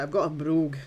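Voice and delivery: playful Scottish accent, savouring the word brogue. Shown as a demonstration. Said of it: "It does sound good though when you say it with a Scottish accent".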